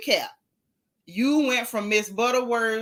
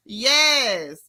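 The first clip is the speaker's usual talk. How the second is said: usual speaking voice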